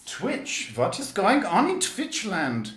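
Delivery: put-on high, loud German accent; mock German accent. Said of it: "I don't know why I'm doing German".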